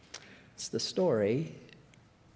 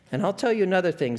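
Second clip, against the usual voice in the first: a lightly voiced ghost refusing charity; the ghost scoffing at the idea of charity, only a hint of a put-on voice; indignant ghost; 6 semitones higher, 4 dB louder, faster than usual. A narrator's reading. Ghost telling the bright spirit he wants no charity, mildly indignant.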